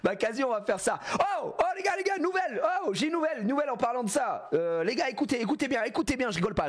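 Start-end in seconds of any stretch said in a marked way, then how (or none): 1.1-6.7 much higher, eager call for attention; excited announcement. Real, not acted. Streamer hails his viewers with big news, excited. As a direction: grab the viewers, bursting with news.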